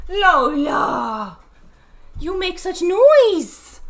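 high, drawn-out pet reprimand. Real, not acted. Owner to dog about the noise, baby-talk scolding. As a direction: chide a noisy pet, slowly and high.

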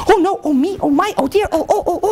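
high, rapid string of fretting ohs; flustered lady in waiting. Acted. Lady in waiting running back to the princess, high and flustered. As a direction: fret in a high put-on voice. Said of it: "like that".

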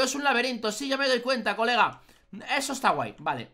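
usual speaking voice

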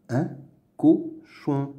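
"Un cochon" as "un", "co", "chon"; syllable by syllable. Shown as a demonstration. Teacher sounding out words to read, "Un cochon" in separate syllables. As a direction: pause after each syllable of "Un cochon". Reading drill, split then blended.

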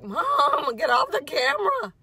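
high, loud whiny dog voice; dog's inner voice. Retold or imitated. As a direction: voice the fed-up dog. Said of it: "Look at her like".

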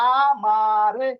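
আমারে stretched very slow and loud, "aa-maa-re"; sounding out slowly. Shown as a demonstration. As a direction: draw out each vowel of আমারে for spelling. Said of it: "এটা যদি লিখতে চান তাহলে আ".

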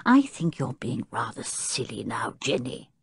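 low, soft, mildly irritated reproof; restrained impatience; impatient. A narrator's reading. Professor tired of the maid's fears, impatient. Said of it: "said the professor impatiently".

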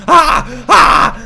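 startled shout. Real, not acted.

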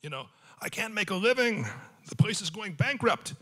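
put-on high, kvetching Yiddish accent. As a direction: complain like an old Yiddish grumbler. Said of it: "they go".

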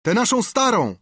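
high, loud call from the crowd; shouting. A narrator's reading. Second sailor requesting the old song, shouting. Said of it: "krzyknął drugi".

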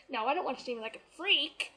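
usual speaking voice

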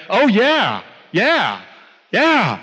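mock-eager, each yeah swooping down; eager agreement. Woman agreeing she believes in the Word, eagerly. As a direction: play up an over-keen believer's agreement.

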